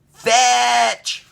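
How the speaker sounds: put-on high, loud child command; little boy command. A narrator's reading. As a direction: call it out like a small boy. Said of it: "he said".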